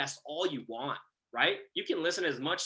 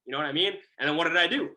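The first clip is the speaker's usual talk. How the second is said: usual speaking voice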